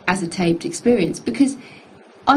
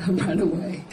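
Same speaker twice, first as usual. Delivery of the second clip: softer, laughing through it; 3 semitones lower, 6 dB quieter than usual. Real, not acted. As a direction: giggle as you repeat it. Repeats it laughing.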